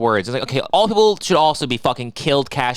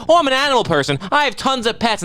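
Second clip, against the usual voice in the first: mock pet owner; 9 semitones higher than usual. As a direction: parody a smug animal person. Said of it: "think that it's acceptable".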